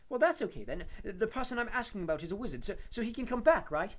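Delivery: put-on high, loud, eager boy's voice; half-laughing with relief; relieved teenage boy.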